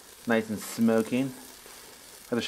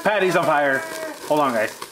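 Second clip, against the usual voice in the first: loud, raised-pitch alert; alarmed call-out; 3 semitones higher, 5 dB louder than usual. Real, not acted. Warning friends the patty is on fire, alarmed call-out. Burger flares up on grill.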